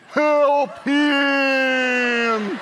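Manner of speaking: high, loud drawn-out howl; neighbor dog. Retold or imitated. Neighbor dog joining in the cry for help, loud drawn-out howl. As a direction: howl the plea long. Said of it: "the neighbor dog joins in".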